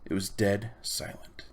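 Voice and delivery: ordinary delivery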